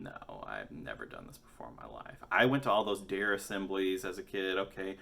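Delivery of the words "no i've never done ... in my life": hushed denial. Retold or imitated. Flustered denial.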